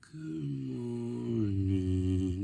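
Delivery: very slow, sleepy good morning; groggy just-woke-up voice. Shown as a demonstration. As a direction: stretch it out like you can barely wake up. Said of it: "you sound like this".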